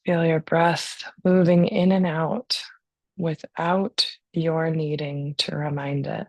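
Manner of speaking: calm, unhurried breathing guidance; guided meditation. Real, not acted.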